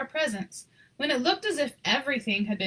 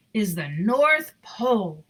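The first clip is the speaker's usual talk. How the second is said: conductor's voice; 3 semitones lower, 4 dB louder, slower than usual. A narrator's reading. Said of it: "said the c-, conductor".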